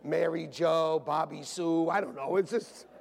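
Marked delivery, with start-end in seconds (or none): none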